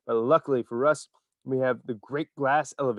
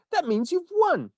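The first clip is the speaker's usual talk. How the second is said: high, slow, overjoyed announcement; excited cry; 5 dB louder, slower than usual. A narrator's reading. Wonka telling Charlie he won, overjoyed. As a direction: crow it with delight. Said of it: "he cried out".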